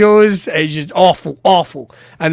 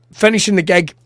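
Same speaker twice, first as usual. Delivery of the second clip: normal talking voice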